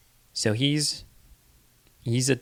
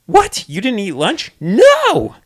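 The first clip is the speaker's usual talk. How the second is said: mock surprise; 14 semitones higher, 11 dB louder, slower than usual. Parent-toddler exchange acted.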